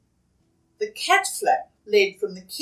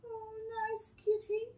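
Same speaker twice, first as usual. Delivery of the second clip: put-on child's voice; 13 semitones higher, 13 dB quieter than usual. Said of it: "He hugged her and said".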